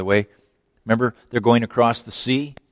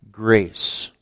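usual speaking voice

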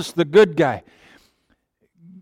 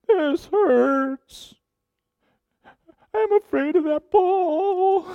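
much higher, slower mock whine; whiny crybaby; much slower than usual. Preacher mimicking a frightened believer, high mock crybaby whine. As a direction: play a scared child whimpering about the ball. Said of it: "like a whiny crybaby".

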